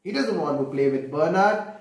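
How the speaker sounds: plain reading voice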